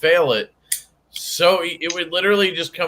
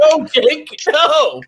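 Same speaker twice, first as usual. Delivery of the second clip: disbelieving protest; 10 semitones higher, 5 dB louder, much slower than usual. Retold or imitated. Retold reaction to Jake's pitch.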